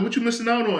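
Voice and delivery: mock exclaim it like an envious friend; excited retold quote. Friend telling him he never misses trips, high and loud.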